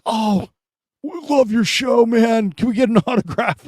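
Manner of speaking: high, eager fan voice with a laugh; a teenager at the stoplight asking for an autograph; excited teen fan. Retold or imitated. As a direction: gush like a starstruck teenager. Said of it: "They're like".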